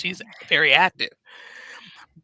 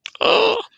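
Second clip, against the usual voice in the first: a sharp, high gasp of shock; 6 dB louder than usual. Retold or imitated. Watching a sad video online, sharp shocked gasp. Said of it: "I'm just sitting going".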